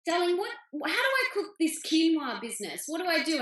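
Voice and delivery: a hint of a flustered mother; imitating Mum. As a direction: play a puzzled mum asking for help on the phone. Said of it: "I'm getting phone calls going".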